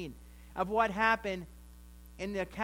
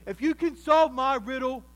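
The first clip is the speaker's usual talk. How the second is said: loud, boastful challenge; a touch of swaggering arrogance; cocky boast; 3 semitones higher, 8 dB louder than usual. Retold or imitated. Samson boasting his riddle to the Philistine men, loud and cocky. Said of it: "In his cockiness and his arrogance".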